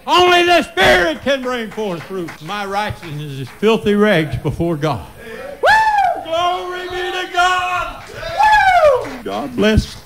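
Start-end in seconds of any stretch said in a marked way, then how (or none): 0.0-2.4 rising preaching cry. Acted. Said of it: "said hushed just before". 5.6-10.0 very high whooping cry of glory; ecstatic praise shout. Real, not acted. Preacher whoops praise to God before the congregation.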